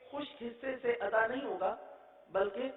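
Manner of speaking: normal talking voice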